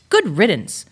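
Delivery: loud, high bark of good riddance; barked dismissal. Acted. Dog barking at the fleeing squirrel, loud and high. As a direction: bark it out, glad to see him go. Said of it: "I'm about to bark".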